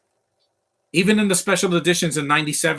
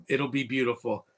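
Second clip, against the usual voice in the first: ordinary delivery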